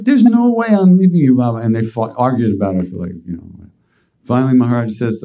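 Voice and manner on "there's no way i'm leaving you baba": much higher and louder, refusing to let go; insistent refusal. Retold or imitated. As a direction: plead that you won't leave him, voice up high. Sadhu insists on going along.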